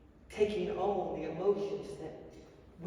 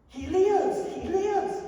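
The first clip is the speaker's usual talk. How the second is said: much higher and louder, ringing out; exultant shout of joy; joyful proclamation; 9 semitones higher, 8 dB louder, much slower than usual. Real, not acted. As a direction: cry it out in triumph.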